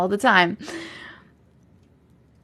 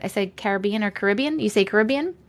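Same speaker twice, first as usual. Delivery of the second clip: normal talking voice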